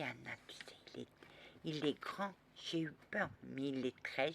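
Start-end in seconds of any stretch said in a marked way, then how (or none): 0.0-1.1 soft unvoiced whisper. Real, not acted. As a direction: whisper it secretly.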